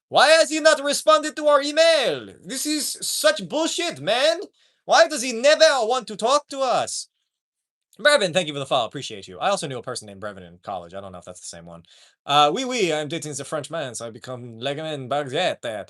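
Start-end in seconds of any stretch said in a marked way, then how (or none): 0.1-7.1 high, hammed-up French complaint; indignant French accent. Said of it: "fucking France is like". 12.5-15.9 mock Frenchman with a ho-ho laugh; lightly hammed-up French bit; bad French accent. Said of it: "I can't do a French accent".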